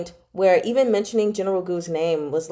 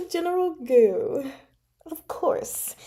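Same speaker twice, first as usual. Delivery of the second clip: high, hushed knowing aside; dramatic reveal; 7 semitones higher, 4 dB quieter, slower than usual. Real, not acted. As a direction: drop it like a juicy twist. Reveal of who is poisoned.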